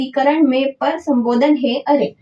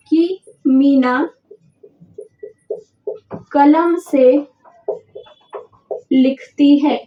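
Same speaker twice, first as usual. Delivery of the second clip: slow dictation; slower than usual. Shown as a demonstration. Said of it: "teacher dictates example slowly".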